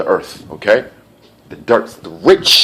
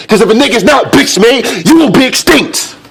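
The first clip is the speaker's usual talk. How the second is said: very high, loud yell; full-voiced, high-pitched outburst; acted shouting; 14 semitones higher, 5 dB louder than usual. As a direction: shout it at the peak of a tirade. Acted tirade reaches its peak.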